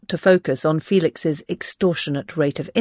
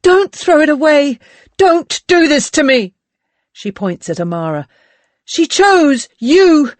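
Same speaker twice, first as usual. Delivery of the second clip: anguished shouting; 10 semitones higher, 6 dB louder than usual. Acted. Berenice's anguished outburst.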